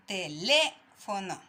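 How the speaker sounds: "Teléfono" said slowly, LÉ in "teléfono" leaned on; syllable stress on LÉ. Shown as a demonstration. Teacher to pupils, showing stress on LÉ in "teléfono". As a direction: drag out "Teléfono", weight on LÉ. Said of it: "Contando desde el final hacia adelante".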